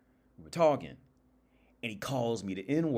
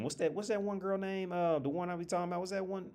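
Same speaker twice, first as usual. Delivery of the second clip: high, softer musing to oneself; searching for a name; 10 semitones higher, 4 dB quieter than usual. Real, not acted. Trying to recall an actress's name, high musing. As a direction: think aloud in a raised, lighter pitch.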